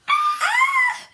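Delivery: very high, loud shriek; demonstrated scream. Shown as a demonstration. Showing a friend how she screamed, piercing scream. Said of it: "How did you scream?".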